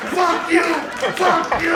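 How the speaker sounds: high-pitched shouted curse, acted out; retold furious cursing. Boy squeezing the nun's head, furious mock shout. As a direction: yell it with effort, as if wrestling.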